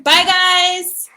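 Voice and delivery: high, drawn-out farewell; high-pitched, friendly send-off; cheery sign-off. Real, not acted. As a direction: call a bright goodbye up high to viewers. Signing off the live stream.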